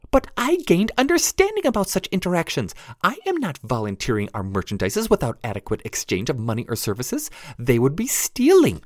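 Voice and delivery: tiny high pixie voice; prim, squeaky little pixie. Acted. Narrator voices pixie Jenny.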